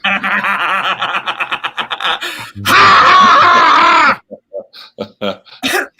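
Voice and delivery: long loud cackling laugh; loud laughter. Real, not acted. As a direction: burst out into a long, rising laugh. Reacts to film title joke.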